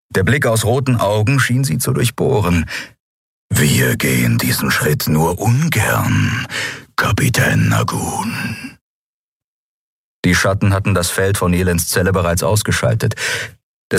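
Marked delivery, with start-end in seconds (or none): 3.5-8.8 low, very slow drawn-out menace; low, slow threatening character voice; menacing villain. Acted. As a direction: stretch each word out, deep and cold.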